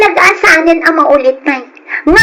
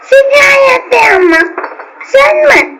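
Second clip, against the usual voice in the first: defiant shout; 10 semitones higher than usual. Acted. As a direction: yell it, refusing to be scolded. Drunk sister talks back to brother. (makapungtot nga ikkis iti kabsat)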